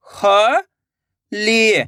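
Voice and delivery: very slow, syllables stretched; slow syllable reading. Shown as a demonstration. Teacher sounding out a word for children, very slowly. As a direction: draw out each syllable. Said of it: "तो मिलके बन जाएगा".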